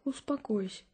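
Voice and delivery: soothing. Acted. Mother soothing upset daughter.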